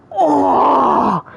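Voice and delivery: anguished groan. Acted. Fan reliving the stabbing, anguished groan. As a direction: wail as if feeling the wound. Mock anguish at the twisted knife.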